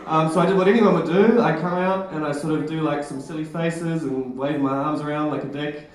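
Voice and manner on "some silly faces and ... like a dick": usual speaking voice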